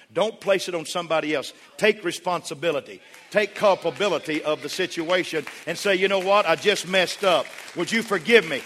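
building to a high, loud preaching shout; impassioned preaching. Real, not acted. Preacher urging husbands to take responsibility, rising to full force.

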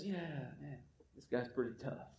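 low, muttered, defeated king; mumbling king Saul. Retold or imitated. Saul shrugging off the giant in a low mutter. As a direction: mutter it like a scared king giving up. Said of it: "He says".

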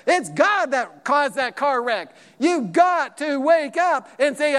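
loud, high, driving sermon delivery; rousing pulpit preaching; full-force preaching. Acted. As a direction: preach it hard to the church.

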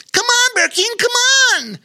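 very high, loud urging; urging Bobo Chico. A narrator's reading. Bobo Chico urging Bear King to paddle, very high and loud. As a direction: cheer him on to paddle harder.

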